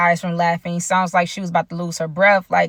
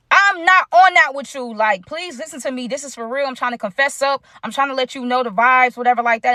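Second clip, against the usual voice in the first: higher-pitched, exasperated retold plea; insistent pleading; 5 semitones higher than usual. Confessing to a girlfriend who won't believe it, pleading insistently. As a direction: plead to be taken seriously, voice lifted.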